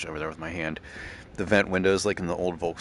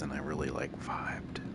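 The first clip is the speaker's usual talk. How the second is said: breathy hushed whisper, savoring it; 4 semitones lower, 7 dB quieter than usual. Real, not acted. Driving to the old radio hit, whispering. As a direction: whisper it like a blissful secret. Vibing to the car's release song.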